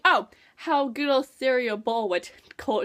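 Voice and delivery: ordinary talk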